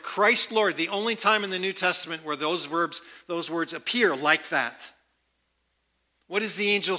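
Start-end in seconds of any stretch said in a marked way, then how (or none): none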